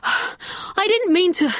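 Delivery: high, breathless question; out of breath from running; gasped. A narrator's reading. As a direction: ask while still gasping for air. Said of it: "he gasped".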